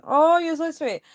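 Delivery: high, loud gushing "oh"; touched. Real, not acted. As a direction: gush at a sweet compliment, pitch swooping down. Reacting to a viewer's compliment.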